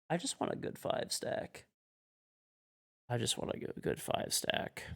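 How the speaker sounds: sighed out softly on a breath, worn out; weary sigh. Real, not acted. Sighing, worn out by games.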